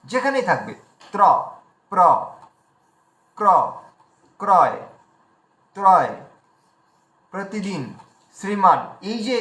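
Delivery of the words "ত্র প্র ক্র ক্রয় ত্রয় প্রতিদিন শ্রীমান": ত্র, প্র, ক্র each alone with a distinct র; clear r clusters. Shown as a demonstration. Teacher drilling for pure pronunciation, clear r clusters. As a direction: separate items with pauses, sound the r in প্রতিদিন and শ্রীমান. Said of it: "এই র ফলার যে টোনটা".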